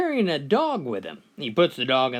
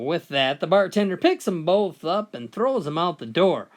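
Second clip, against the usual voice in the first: normal talking voice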